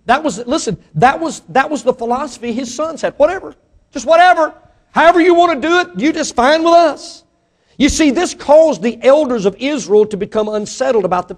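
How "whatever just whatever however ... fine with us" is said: higher, airy mock indifference; mocking carefree whatever. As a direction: toss it off like a careless brat. Mocking the sons' careless attitude.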